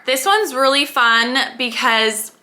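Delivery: ordinary delivery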